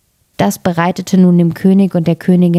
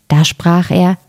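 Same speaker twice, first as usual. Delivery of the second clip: ordinary delivery, nothing marked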